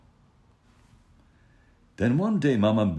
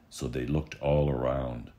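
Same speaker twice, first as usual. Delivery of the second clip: ordinary delivery, nothing marked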